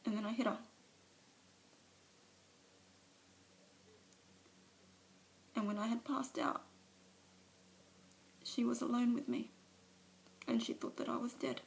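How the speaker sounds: Alice breaking down before the meeting; tearful confession. Acted. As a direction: let the shame and grief spill over. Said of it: "a monologue from one of, of her movies".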